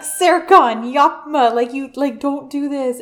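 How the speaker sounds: hammed-up cry of protest; retold alarmed protest. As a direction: call it out urgently, imitating her. Acted protest in a retold scene.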